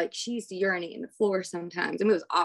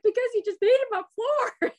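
high-pitched exasperated wail, played for laughs; mock crying complaint; 5 dB louder than usual. Crying mother answers daughter about the floor, mock wail. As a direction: wail it in a put-on tearful voice. Acting out her tearful, exasperated reply.

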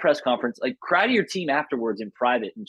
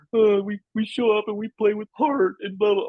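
sing-song, higher mock of a stock athlete line; mocking press-conference cliché; 9 semitones higher, 3 dB louder than usual. Brushing off the canned press answer with a mocking, higher voice. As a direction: parody the canned heart-and-hustle answer, a bit higher.

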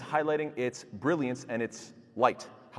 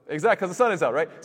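excited answer; 6 semitones higher, 7 dB louder than usual. Real, not acted. Answer to his own quiz.

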